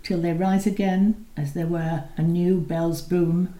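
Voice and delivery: ordinary delivery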